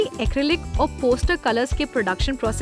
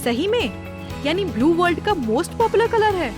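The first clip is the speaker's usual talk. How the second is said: higher-pitched, intrigued reaction; surprised question; 6 semitones higher than usual. Acted. Co-narrator reacting to the fact about blue, surprised.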